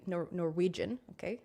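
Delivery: normal talking voice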